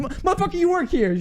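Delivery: high-pitched mock outrage; incredulous protest. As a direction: protest way up high, as if it's absurd. Retold protest to the worker.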